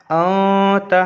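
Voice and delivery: "औ ता" with the n held long in the nose; ikhfa nasal hold. Shown as a demonstration. Teacher shows students how to read it, ikhfa nasal hold. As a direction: hum the nasal through "औ" before releasing "ता". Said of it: "आवाज़ को ना नाक में थोड़ा सा मतलब रोक कर पढ़ेंगे".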